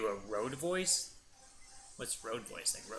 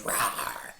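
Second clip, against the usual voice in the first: rough toneless roar; imitated monster roar; 4 dB louder than usual. Father imitating his daughter seeing big Pokemon, monster roar. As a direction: roar like a toddler playing monster. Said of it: "She goes".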